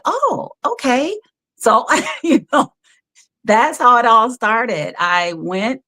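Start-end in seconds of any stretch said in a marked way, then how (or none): 0.1-1.2 higher and slow, a pleased little oh okay; high surprised okay. Retold or imitated. Told to wear her own clothes, she answers high and slow. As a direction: let it float up high, slowly, in surprise. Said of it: "And I'm like".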